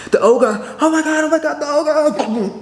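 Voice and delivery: high, frantic mock terror, pitch shooting way up; mock panic. Scorpio panicking at the ogre swinging an axe, frantic high mock terror. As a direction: squeal it out like you're losing it.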